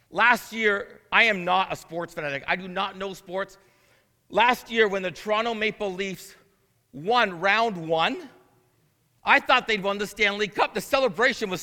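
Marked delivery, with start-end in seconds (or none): none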